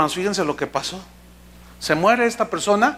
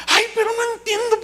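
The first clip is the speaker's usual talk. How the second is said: high-pitched imitated lament; mock wail. Relative cannot grasp the widow's calm, mock wailing. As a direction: whine it high like a distraught relative. Mimics uncomprehending weeping relative.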